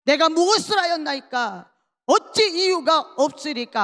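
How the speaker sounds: loud protest, falling at the end; acted loud retort. David answering his scolding brother undaunted, loud retort. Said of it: "오히려 큰 소리 칩니다".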